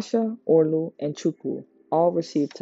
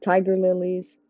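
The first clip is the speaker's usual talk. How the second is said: usual speaking voice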